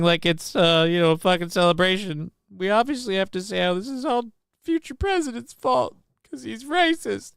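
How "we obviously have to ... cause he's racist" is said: sarcastic. Real, not acted. Sarcastic mock of blame narrative.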